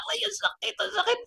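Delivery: very high, whimpering yelps; hammed-up cries of someone being hit; acted pain. Teacher taking the child's blows, high mock cries. Adult being spanked in story.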